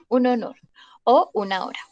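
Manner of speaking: ordinary delivery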